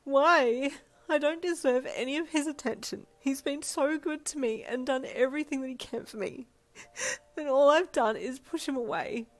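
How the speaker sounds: loud, high, sobbing outburst; anguished wail. A narrator's reading. Listener breaking down before Tansy over being loved, anguished wail. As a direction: wail it out in tears, full voice. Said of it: "you wailed".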